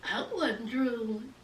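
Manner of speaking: very soft, low mock denial; imitated meek denial. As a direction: mumble a denial as someone caught out. Acting out Clifford's small denial.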